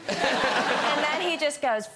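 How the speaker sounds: ordinary talk, nothing marked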